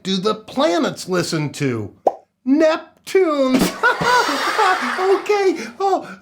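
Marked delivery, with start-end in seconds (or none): 3.7-5.0 high delighted laugh at the pun. Real, not acted. Host laughs at his planet joke, high laugh. 5.0-6.2 high okay still laughing. Real, not acted. Host recovering after the Neptunes joke, laughing.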